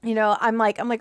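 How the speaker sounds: ordinary talk, nothing marked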